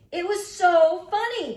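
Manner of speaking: high loud slow squeal of delight; gleeful shout. A narrator's reading. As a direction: relish every word, loud. Carla gleefully repeats.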